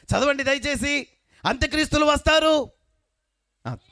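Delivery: high, loud, slow scripture call; full-force preaching. Acted. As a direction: call the verse out over the congregation. Preacher leads scripture reading. (పెద్ద గొంతుతో వాక్యం చదవడం)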